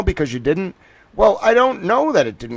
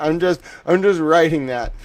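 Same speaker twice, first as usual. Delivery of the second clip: normal talking voice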